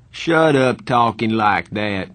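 slow, loud, fierce order. A narrator's reading. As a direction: drawl it forcefully to shut them up. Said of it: "Two-Bit said fiercely".

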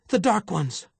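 high, loud, frightened outcry; frightened woman blurting out what happened; terrified. A narrator's reading. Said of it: "Juana's eyes were wide and terrified".

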